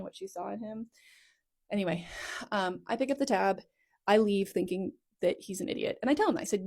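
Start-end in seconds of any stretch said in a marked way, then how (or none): none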